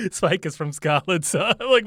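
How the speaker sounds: laughing through the words, pitch jumping up; giggling in embarrassment at own mispronunciation. Real, not acted. Host laughing at himself after botching a Scottish place name.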